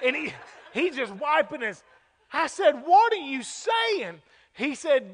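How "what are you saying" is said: high, drawn-out question; baffled questioning. Retold or imitated. Preacher retelling how he asked Mister James to explain, baffled questioning. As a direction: stretch it out, pressing for sense.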